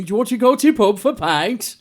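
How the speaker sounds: high-pitched mock Yorkshire; broad Yorkshire pub invitation; Yorkshire accent. Shown as a demonstration. Inviting mates to the pub, Yorkshire accent. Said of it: "I can do a wee bit of that".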